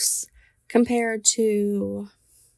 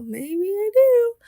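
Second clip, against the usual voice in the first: startled admission; 10 semitones higher, 8 dB louder, slower than usual. Retold or imitated. Admitting belief in ghosts.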